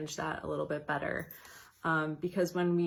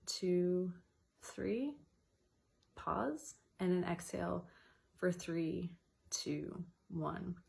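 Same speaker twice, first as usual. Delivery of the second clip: softer and softer counting, pause held; counted breathing; 3 dB quieter, slower than usual. Shown as a demonstration. Guiding listeners through timed breathing, counting softly down. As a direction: guide the breath count gently, fading. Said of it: "So it's an in".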